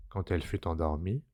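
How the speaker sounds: plain reading voice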